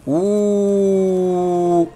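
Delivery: soft, level, drawn-out ooh; sustained quiet ooh on one note; long held "oo". Real, not acted. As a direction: hold a long steady ooh, softly. Reacts to the game.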